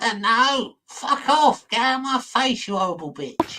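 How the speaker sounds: a hammed-up toddler telling her off; angry little kid. Imagining the kid telling the carer to go away, mock child voice. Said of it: "That little kid's like".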